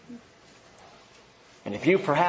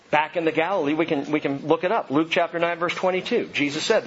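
usual speaking voice

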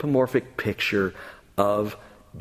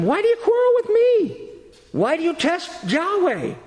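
very high, louder indignant retort; exasperated protest; 3 dB louder than usual. Acted. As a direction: throw the questions back in high-pitched exasperation. Moses acted answering quarrelling people.

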